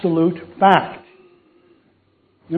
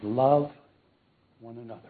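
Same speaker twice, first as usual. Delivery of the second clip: much softer, fading to near silence; John's gentle reply; 6 semitones lower, 11 dB quieter than usual. Retold or imitated. Old John answering his eager followers, softly. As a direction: say it softly like a frail old apostle.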